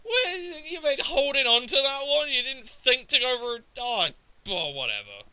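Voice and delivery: high-pitched, disbelieving complaint; indignant retold outburst; exasperated disappointment. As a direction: whine at the opponent in retold dismay. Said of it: "I'm just so disappointed".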